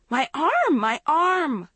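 high, loud cry of pain; wailing in pain when touched; cried shrilly. A narrator's reading. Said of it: "she cried shrilly".

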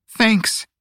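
muffled through a scarf. A narrator's reading. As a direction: thank from behind a scarf. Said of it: "came Shio's muffled voice".

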